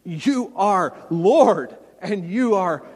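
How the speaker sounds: loud, slow confession of faith; crying out. Acted. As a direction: declare it with drawn-out, ringing conviction. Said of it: "crying out with a personal confession of faith".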